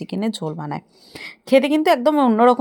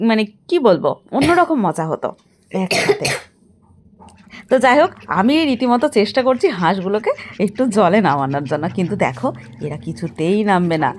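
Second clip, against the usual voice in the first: normal talking voice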